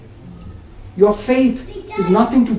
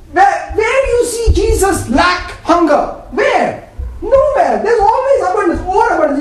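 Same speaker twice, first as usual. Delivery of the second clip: joyful preaching; 8 semitones higher than usual. Real, not acted. Preaching climax, laughing.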